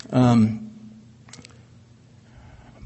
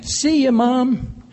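carefree laughing farewell; 11 semitones higher, much slower than usual. Retold or imitated. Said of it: "and I'd be laughing".

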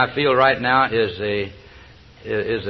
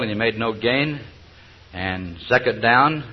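ordinary talk

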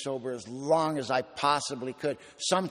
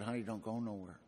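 tender plea, softly; 3 semitones lower, 10 dB quieter than usual. Retold or imitated. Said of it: "and said".

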